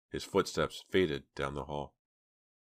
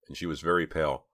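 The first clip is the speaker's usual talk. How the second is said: ordinary delivery, nothing marked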